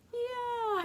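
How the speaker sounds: very high, drawn-out little voice; high character voice. Pretend. Answers for George and Martha, high character voice. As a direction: squeak out a reply.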